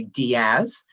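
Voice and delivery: Diaz said as dee-AHS, Spanish vowels; Spanish pronunciation of Diaz. Shown as a demonstration. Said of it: "if we're looking at a Spanish dialect".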